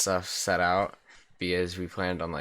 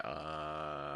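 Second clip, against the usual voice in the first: soft, low guilty uh; caught-out quiet uh; 4 semitones lower, 10 dB quieter than usual. Retold or imitated. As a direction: trail off in guilt. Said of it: "And I'm like".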